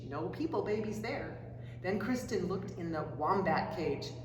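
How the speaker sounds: ordinary delivery, nothing marked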